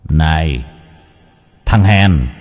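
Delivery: lightly voiced taunting shadow, low; slow, low call from an inner villain; sinister shadow. A narrator's reading. (giọng cái bóng ác tâm khiêu khích)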